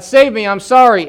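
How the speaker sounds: high, loud apology; crying out to God. Retold or imitated. Drug user begging God, high loud cry. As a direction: plead loudly to be rescued. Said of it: "He cried out to God".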